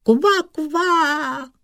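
very high frog squeal of joy; joyful cry. A narrator's reading. Frog lady sees blue sky in the water, joyful cry. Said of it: "vykřikla radostí".

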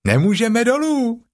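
high little kangaroo voice; Roo calling. A narrator's reading. Roo calling down that they are stuck, high. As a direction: chirp it down cheerfully. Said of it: "zvolal klokánek".

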